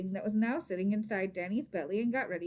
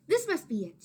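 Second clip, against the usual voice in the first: eager, higher and louder; excited; 7 semitones higher, 6 dB louder than usual. A narrator's reading. Maria to her cousins, eager and excited. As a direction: sure she has found it. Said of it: "she said".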